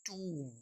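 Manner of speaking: टूम said "toom"; long "oo" closing on M in टूम; silent B. Shown as a demonstration. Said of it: "इसे पढ़ते हैं". (टूम में लंबा "ऊ", बी चुप)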